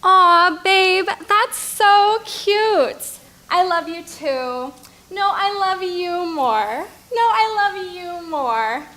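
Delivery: slow, loud lovey-dovey cooing; giggly late night call. Acted. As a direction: coo into the phone at a boyfriend. Cooing on phone with boyfriend.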